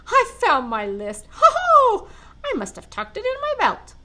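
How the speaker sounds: big jolly Santa voice, high and loud on Ho-ho. Acted. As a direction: boom out a merry Ho-ho. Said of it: "suddenly jolly again".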